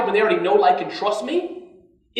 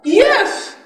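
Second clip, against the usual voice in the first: a sudden high, loud cry of triumph; excited yes; 12 semitones higher, 7 dB louder than usual. Real, not acted. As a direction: burst out with delight at the answer. Audience guesses what it's for.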